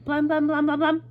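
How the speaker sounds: loud, high, rapid-fire knocking noise; booming repeated knocks on one high note; banging knock. A narrator's reading. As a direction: pound on the door with the voice. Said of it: "knocked on the door".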